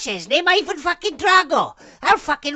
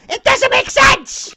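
very high, loud, stretched protest; exasperated yelling; 11 semitones higher, 5 dB louder, slower than usual. Real, not acted. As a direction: screech it in disbelief. Ranting at odd game name.

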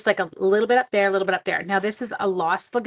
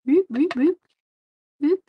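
high, loud little boops; playful boop noises; 7 semitones higher, 6 dB louder than usual. Real, not acted. Crafter making noises as she works the ink in, playful high boops. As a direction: chirp out short cartoonish boops. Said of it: "You have to make those noises".

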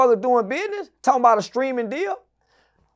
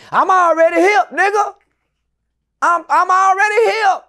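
emphatic boast; 6 semitones higher, 3 dB louder than usual. Real, not acted. Boasting he's hip.

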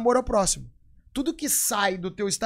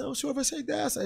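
mock pitying visitor; 7 semitones higher than usual. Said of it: "falava assim".

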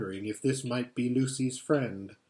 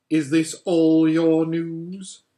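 slow, louder icy question; cruel, slow; 5 dB louder, slower than usual. Acted. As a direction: savour his failure cruelly. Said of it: "slow, cruel smile".

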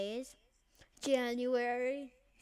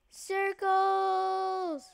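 excited shout; 9 semitones higher, 7 dB louder than usual. Real, not acted. Spotting circles in game.

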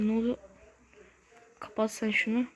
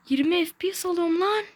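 much higher, louder frustrated cry; exasperated outburst; 8 semitones higher, 3 dB louder than usual. Real, not acted. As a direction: blow up at the lag. Repeats lag complaint, exasperated.

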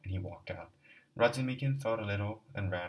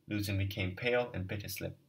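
ordinary delivery, nothing marked

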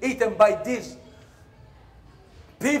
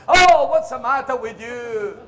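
indignant yelp; 5 semitones higher, 8 dB louder than usual. Retold or imitated. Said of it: "which hurt so much".

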